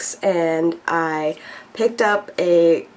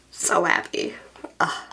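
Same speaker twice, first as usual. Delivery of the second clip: whisper; 5 dB quieter than usual. Real, not acted. Whispered joy.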